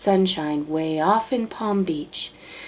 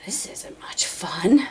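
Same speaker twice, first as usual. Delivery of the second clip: whispered, unhappy grumble; the cold, wet elephant, whisper-grumbling. Acted. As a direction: grumble it under your breath. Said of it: "the poor elephant grumbled".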